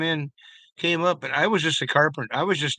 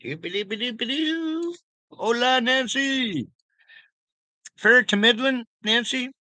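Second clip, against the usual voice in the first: playful high singsong; 10 semitones higher than usual. Pretend. Singsong to pet.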